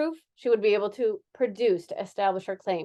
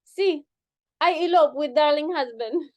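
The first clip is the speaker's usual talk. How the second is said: hammed-up Latin accent, higher; West Side Story accent; 5 semitones higher, 4 dB louder than usual. Dancer explains her elopement in hammy Latin accent. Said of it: "West Side Story impersonation".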